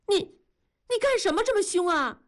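high, quick, wounded protest; stammer, then protest in a high rush; startled protest. A narrator's reading. Frightened wife answers her glaring husband, startled high protest.

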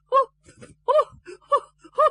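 short, broken would-be sobs; stifled sob attempts. A narrator's reading. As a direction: try to cry and only get bursts out. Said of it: "火了半天没哭出来". (想哭哭不出来的抽噎)

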